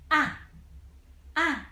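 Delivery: "ah" short, falling from high to bottom; falling fourth tone. Shown as a demonstration. Teacher shows fourth tone sound, pitch falling steeply. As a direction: drop "ah" sharply from your highest voice. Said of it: "keep dropping down to the bottom".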